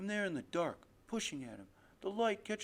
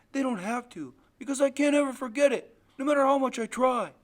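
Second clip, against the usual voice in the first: put-on high, loud child's protest, building; anguished insistence; 4 semitones higher, 10 dB louder than usual. Boy tells father he can't forget, anguished insistence. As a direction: insist with rising force.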